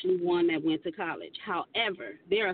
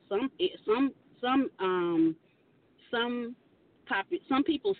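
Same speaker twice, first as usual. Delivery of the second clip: stumbling stutter on some; halting, stuttering restarts; 5 semitones higher than usual. Real, not acted. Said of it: "it sounded like I was stuttering".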